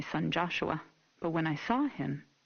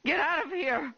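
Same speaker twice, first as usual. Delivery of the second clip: angry shout; 9 semitones higher, 3 dB louder than usual. Acted. Mother fighting officer.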